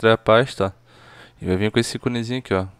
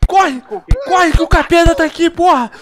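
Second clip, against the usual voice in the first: being chased; 6 dB louder than usual. Real, not acted. Fleeing the monster.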